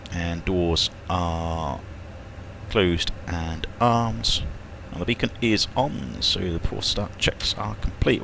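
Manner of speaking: very slow, drawn-out checklist reading. Real, not acted. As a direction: stretch each item as you tick it off. Reads the checklist aloud.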